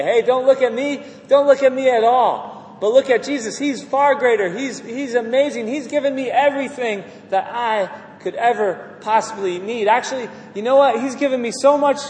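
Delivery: acted testimony, eagerly pointing away from yourself; fervent testimony. Exalting Jesus in acted testimony.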